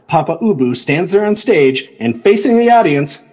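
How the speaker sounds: ordinary delivery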